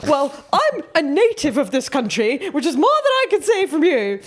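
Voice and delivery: high-pitched, haughty posh English indignation; hammed-up outrage from a posh old lady; mad posh old woman. Imitating old woman snubbing foreign cyclist, mad posh voice. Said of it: "She got so mad".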